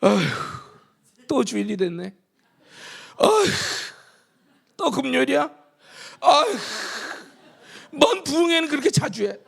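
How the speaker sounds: sighing, whiny churchgoer complaint; mock weary grumbling. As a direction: ham up a bored believer's moaning. (지겨워하는 신자를 흉내 낸 한숨 섞인 투정)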